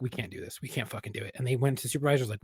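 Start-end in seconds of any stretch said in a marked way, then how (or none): 0.0-1.3 much softer, worn out; drained. Retold or imitated. Exhausted officers telling supervisors they're done, drained and soft. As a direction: say it with nothing left. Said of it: "They're like".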